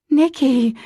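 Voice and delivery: loud, high call of his name. Acted. Mother finds her son under the house, loud call. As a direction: call out with relief. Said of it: "she called".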